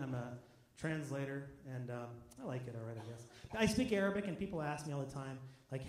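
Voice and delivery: normal talking voice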